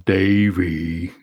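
deep slow cartoon call of Davey; low cartoon voice. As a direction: drag out a low goofy Davey. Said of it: "and goes".

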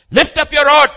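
high, loud, urgent order; shouted command. Acted. God orders Moses to act at the sea, shouted command. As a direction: command it at full voice.

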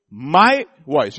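"my" drawn out and leaned on; stress on MY. Real, not acted. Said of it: "the emphasis on the word my".

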